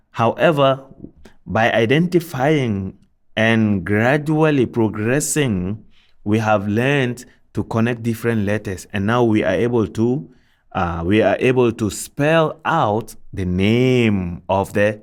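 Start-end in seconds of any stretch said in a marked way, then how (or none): none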